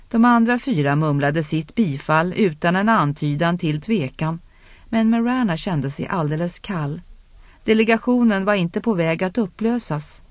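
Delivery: usual speaking voice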